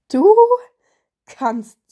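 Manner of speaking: halting, incredulous gasp; gasping raven. A narrator's reading. Raven mocks the winter spirit's singing, gasping. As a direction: gasp it out in amused disbelief. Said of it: "japperte er".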